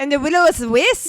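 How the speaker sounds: hammed-up Scottish; Scottish accent. Podcaster quoting the film for listeners, Scottish accent. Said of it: "you gotta do it for the people now".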